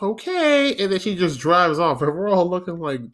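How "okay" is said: put-on high, sweet old lady. Old woman fretting over a boy, high and sweet. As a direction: coo it like a worried grandma. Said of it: "she goes".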